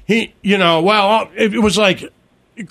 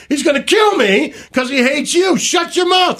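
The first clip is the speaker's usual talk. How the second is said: mock teammate yell; 8 semitones higher than usual. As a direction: shout him down, half-joking. Teammate yelling at punter.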